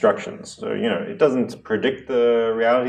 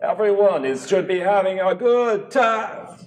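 put-on drunk king; 12 semitones higher than usual. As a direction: slur it out, merrily sozzled. Drunk king's last words.

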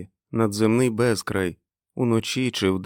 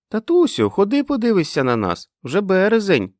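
put-on high, loud little-girl call; high-pitched childish shouting; child's excited cry; 12 semitones higher, 5 dB louder than usual. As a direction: call out to daddy like an eager child. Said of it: "кричала Мег".

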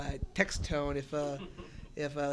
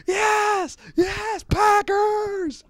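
much higher, much louder triumphant yell; joyful shout; 9 dB louder, much slower than usual. Retold or imitated. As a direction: shout it like your team just scored. Retelling his joyful reaction.

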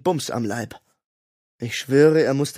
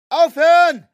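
much higher, loud desperate shout; full shout; yelling stop; 9 dB louder than usual. A narrator's reading. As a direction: scream to make them stop. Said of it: "brüllte ich".

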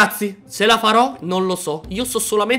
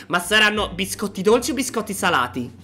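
ordinary delivery

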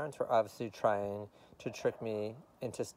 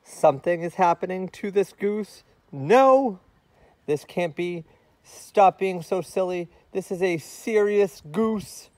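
higher, louder panicked protest; rising alarm; 9 semitones higher, 12 dB louder than usual. A narrator's reading. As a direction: fight off a giggle in alarm. Goose panics as it cracks.

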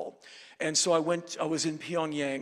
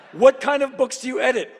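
mock nosy minder; 9 semitones higher, 11 dB louder than usual. Minder asking about his work.

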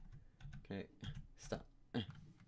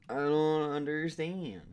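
drawn out, louder and higher whine; mock despair; 5 semitones higher, 6 dB louder, slower than usual. Shown as a demonstration. Gamer whining at the puzzle in mock despair. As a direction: wail it in mock frustration. Repeats line, second more dramatic.

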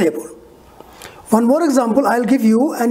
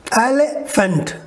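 Elephant broken into slow separate beats; syllable by syllable; slower than usual. Shown as a demonstration. Teacher counting strokes in a word for students, slow syllable-by-syllable. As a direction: say Elephant slowly, el-e-phant, one beat each. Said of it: "Three strokes".

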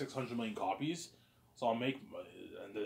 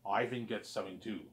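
ordinary talk, nothing marked

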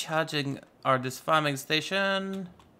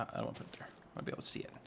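whispered; 4 semitones lower, 15 dB quieter, much faster than usual. Real, not acted. Muttering to himself.

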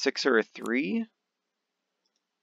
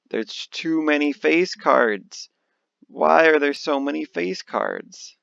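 higher, louder exasperated complaint; 5 semitones higher, 5 dB louder than usual. Real, not acted. Card player stuck with too many face cards, exasperated. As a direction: complain in real frustration at the cards.

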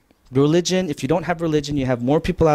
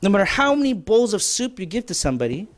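raised voice; 6 semitones higher than usual. Real, not acted. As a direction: voice rising high in frustration. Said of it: "I'm sorry if I raised my voice there".

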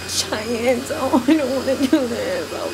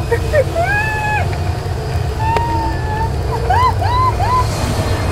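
long, high, panicky laugh; nervous high laugh. Real, not acted. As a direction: laugh out the fear.

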